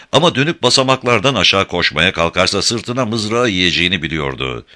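ordinary delivery, nothing marked

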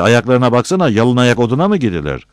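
high, loud mockery; jeering. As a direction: heckle him for all to hear. Villager jeers at bare feet.

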